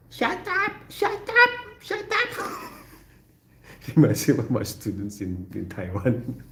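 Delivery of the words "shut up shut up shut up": very high squealing mock kid yelling shut up; imitating a screaming kid. Retelling the neighbor's kid, high mock screaming. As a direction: squeal it like a shrieking child. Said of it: "Neighbor's kid keeps sleeping, screaming".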